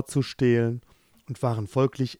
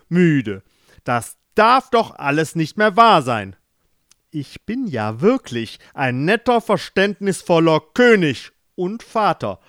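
angry king; 4 semitones higher, 6 dB louder than usual. Acted. King scolds sleepy daughters.

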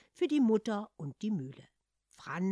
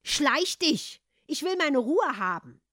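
loud, high snap; irritated dismissal; 8 semitones higher, 9 dB louder, slower than usual. Acted. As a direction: brush him off. Hans dismisses the cat.

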